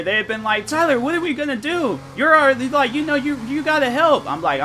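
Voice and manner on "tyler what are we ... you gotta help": much higher, whiny begging voice; mock pleading friends. Friends at the fire begging Tyler for help, mock whiny pleading. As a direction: beg like a helpless whiner, hammed up.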